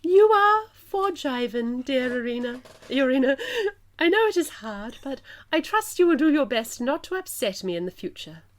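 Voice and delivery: gracious but firm plummy English royal; plummy English queen granting pardon; plummy English accent. Acted. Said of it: "my plummiest English accent".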